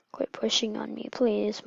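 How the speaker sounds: usual speaking voice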